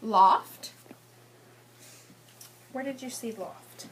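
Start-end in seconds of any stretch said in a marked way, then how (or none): none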